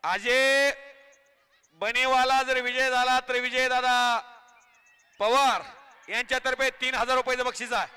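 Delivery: rousing announcer patter over the crowd; live commentary. Acted. Commentator hyping the crowd at the kusti ground, announcer patter.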